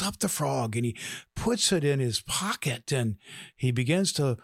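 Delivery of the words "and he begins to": ordinary delivery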